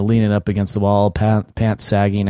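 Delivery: ordinary talk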